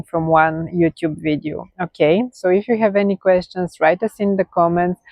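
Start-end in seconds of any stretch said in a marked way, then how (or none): none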